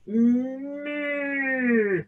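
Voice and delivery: imitated small moo, drawn out; little moo. A narrator's reading. Distant cow answers Tam, little moo. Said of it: "a little moo".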